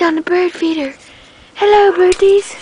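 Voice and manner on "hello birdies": bright high-pitched hello to birds; high sing-song greeting. Real, not acted.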